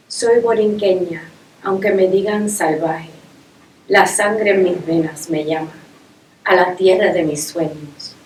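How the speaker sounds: loud, declaimed Spanish verse; Spanish poem recital. Acted. Poet declaring love of her island to an audience, proud declamation. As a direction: proclaim each line, pausing after it.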